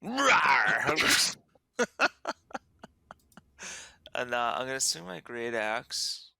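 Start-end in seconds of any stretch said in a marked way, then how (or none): 0.0-1.4 loud, high monster roar; mean roar. Acted. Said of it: "Let's have a mean roar". 1.8-2.3 high burst of laughter. Real, not acted. Laughs after the roar.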